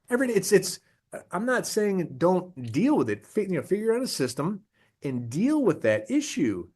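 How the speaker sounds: ordinary delivery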